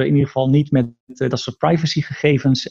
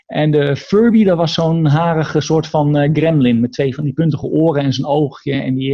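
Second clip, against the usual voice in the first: ordinary talk, nothing marked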